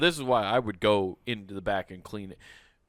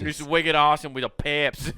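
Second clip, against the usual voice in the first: put-on Boston accent; 4 dB louder than usual. Mock Boston clam bake.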